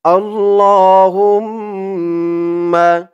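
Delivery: slow, drawn-out recited Arabic; model recitation. Shown as a demonstration. Teacher models the word for viewers to repeat, slow recitation. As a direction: stretch every syllable for them to copy. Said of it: "আগে ধরুন আরবিটা".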